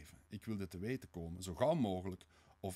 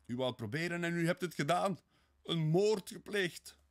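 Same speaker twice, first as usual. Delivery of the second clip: high, loud shock, slowing at the end; horrified outcry; 9 semitones higher, 7 dB louder than usual. Acted. Sonja hears his murder confession, horrified outcry. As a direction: cry it out aghast.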